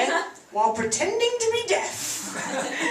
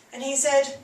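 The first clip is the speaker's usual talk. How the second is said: ordinary talk, nothing marked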